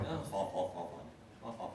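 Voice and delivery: quiet mock fake laughter; forced polite ha-ha.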